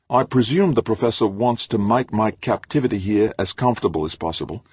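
looking down on her; haughty. A narrator's reading. Haughty captive.

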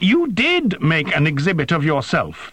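high-pitched angry interruption; shouted. A narrator's reading. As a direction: cut in with an indignant high cry. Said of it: "the machine shouted".

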